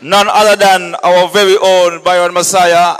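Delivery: loud MC call over the music; hype announcement. Real, not acted. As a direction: announce the artist like a stage host.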